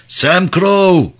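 high, loud call from far off. Acted. Woman on the platform calling up to Crow, high shout. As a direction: shout his full name across the deck. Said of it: "Biri onu çağırıyordu".

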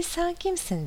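understated high shout; mild narrated shout. A narrator's reading. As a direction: call out high, as a storyteller voicing a shout. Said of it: "diye bağırıyor".

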